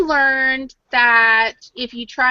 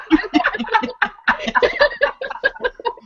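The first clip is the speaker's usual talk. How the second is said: high burst of laughter; real, much higher giggle; bright laugh; 12 semitones higher than usual. Real, not acted. Laughing at the story.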